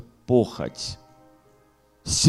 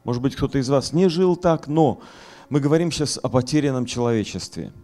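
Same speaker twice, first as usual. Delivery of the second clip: raised, forceful pulpit voice; fiery preaching; 9 semitones higher, 6 dB louder than usual. Acted. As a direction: preach it hard to the hall.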